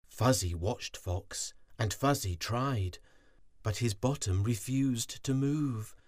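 usual speaking voice

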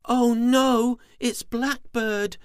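high, loud worried warning. Acted. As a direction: warn her brother in alarm. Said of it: "Twirly Worm warned".

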